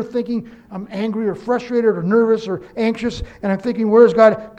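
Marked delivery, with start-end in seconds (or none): 3.9-4.6 exasperated cry. Retold or imitated. Preacher voicing his own frustration.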